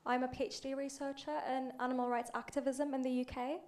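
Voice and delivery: usual speaking voice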